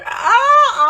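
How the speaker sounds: high, loud yelped cry of pleasure; mock cry out. Narrator voicing Lisa crying out in the car. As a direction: let out a sudden high shout. Lisa's outcry in story.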